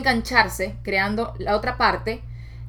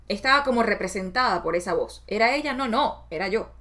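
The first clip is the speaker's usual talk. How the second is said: ordinary talk, nothing marked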